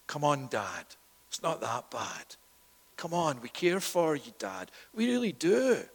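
soft, coaxing false comfort; mock consoling. Hypocrite sons to their father Jacob, soft mock consoling. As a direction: coax gently, lifting on the last assurance.

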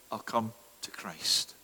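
trailing into a whisper. Acted. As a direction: whisper the excuse away. Voicing a procrastinating listener.

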